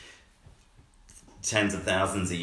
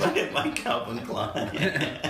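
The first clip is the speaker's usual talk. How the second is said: sultry perfume ad; 7 semitones higher, much slower than usual. Pretend. Mock perfume ad.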